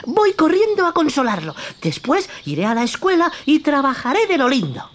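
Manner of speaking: put-on high, childlike wooden puppet voice; Pinocchio's voice. Pinocchio coaxes the cricket toward the theatre, high childlike voice.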